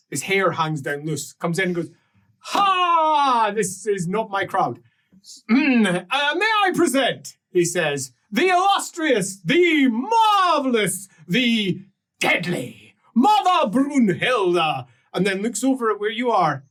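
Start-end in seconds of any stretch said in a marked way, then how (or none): 2.4-4.8 booming, drawn-out "Ha!", higher at first; showy grand entrance. Acted. Swaggering newcomer makes an entrance. 5.5-7.4 raised, high announcer voice; grand herald. Acted. Showman introduces a great lady. 8.3-14.9 high, slow, drawn-out herald's call; grand announcement. Acted. Herald announces Mother Brunhilde's titles.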